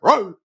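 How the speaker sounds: high, loud mock roar; imitated bark. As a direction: bark it at the camera like a bulldog fan. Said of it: "he barked at us".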